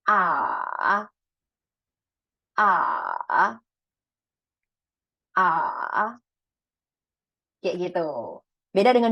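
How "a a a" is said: A dipping low, then rising; third tone A. Shown as a demonstration. Teacher demonstrating tone three, dipping A. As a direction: drop A down low and bring it back up. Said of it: "Seperti apa bunyinya?".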